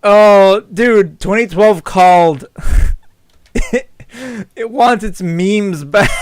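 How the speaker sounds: slow, drawn-out words between laughs; laughing. Real, not acted. Player joking about an old meme, laughing. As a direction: stretch the joke out, amused. Amused reaction to dated meme.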